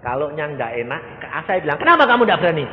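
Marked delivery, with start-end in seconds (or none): 1.8-2.7 loud, high demand; challenging question. Retold or imitated. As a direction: push him to answer. Pastor challenging reluctant relative. (pertanyaan menantang dengan keras)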